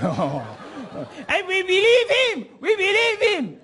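very high, drawn-out squeal; laughing disbelief. Retold or imitated. Preacher laughs at his youthful gullibility, high squealed disbelief. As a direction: squeal it out in amused incredulity. Mocking their own former credulity.

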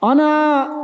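shouting "Ana" for echo; calling out to hear echo. Real, not acted. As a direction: yell "Ana" high into the valley. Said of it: "Yankı yapıyor".

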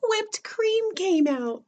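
high, drawn-out storytelling reveal; slow singsong surprise, high-pitched. A narrator's reading. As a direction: stretch it out high for the young listeners.

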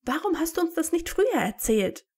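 high, indignant question; astonished shriek. A narrator's reading. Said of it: "kreischte Hermine erstaunt".

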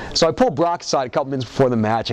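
ordinary talk, nothing marked

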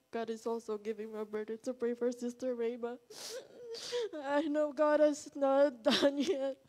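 tearful pleading through tears; crying. Real, not acted. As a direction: speak while crying, voice rising. Tearful testimony, cry heard.

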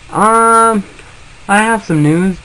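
normal talking voice

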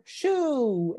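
high, drawn-out shooing cry; yelled. A narrator's reading. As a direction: yell high to scare it away. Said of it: "everyone yelled".

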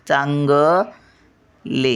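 "चांगले," drawn out very slowly, nasal first syllable held; उच्चार उचलून. Shown as a demonstration. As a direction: hold the "चां" in "चांगले," long. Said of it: "उचलून धरतात". ("चांगले," उचलून, लांबवून म्हणा)

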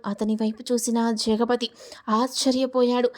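plain reading voice

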